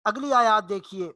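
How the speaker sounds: ordinary talk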